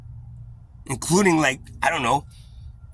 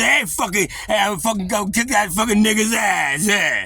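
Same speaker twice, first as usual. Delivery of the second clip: loud tough-guy shout, higher; imitating brother's shout; 9 semitones higher, 8 dB louder than usual. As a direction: bark it like a street bully. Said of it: "He would say".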